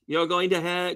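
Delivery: ordinary talk, nothing marked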